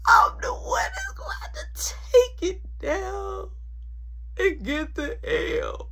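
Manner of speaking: high frantic whispered pleading; pleading with friend not to wake mom; panicked pleading. Retold or imitated. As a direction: beg in a strangled half-shout. Begging friend not to ring.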